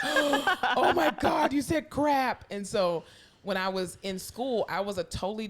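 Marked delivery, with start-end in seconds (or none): 0.0-2.4 loud, high, laughing gasp of scandal; mock shock. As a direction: act out scandalized kids, stretching it out. Children's shocked reaction, retold.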